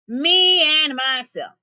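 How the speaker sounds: slow, loud, high proud roar; bellowing lion boast. A narrator's reading. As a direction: belt it out like a lion. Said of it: "He roared just once".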